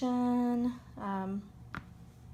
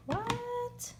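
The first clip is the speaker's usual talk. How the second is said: mock outrage; 7 semitones higher than usual. Mock outrage at discontinued color.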